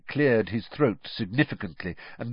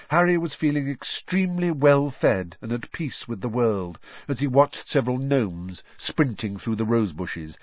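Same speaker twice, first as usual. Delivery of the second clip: usual speaking voice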